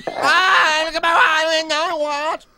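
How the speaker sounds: high, loud, drawn-out mock Vietnamese; over-the-top shouted Vietnamese impression; mock Vietnamese accent. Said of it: "What, Vietnamese accent?".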